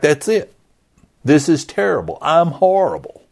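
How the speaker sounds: high-pitched, acted self-reproach; exasperated defeat. Preacher playing Peter after being rebuked, exasperated and defeated. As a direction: throw up a high, fed-up lament. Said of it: "He can't win. He's just like".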